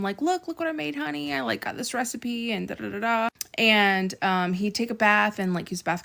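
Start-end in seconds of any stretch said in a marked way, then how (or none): 0.2-3.3 light, high mock excitement trailing down; excited retold quote. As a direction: imitate yourself excitedly showing off. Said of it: "I'd be all excited to show him".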